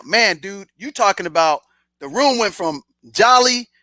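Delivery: ordinary talk, nothing marked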